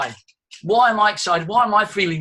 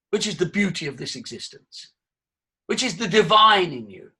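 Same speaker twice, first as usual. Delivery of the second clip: usual speaking voice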